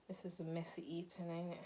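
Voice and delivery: soft whisper between bites. Real, not acted. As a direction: whisper it, mouth near full. Whisper while eating.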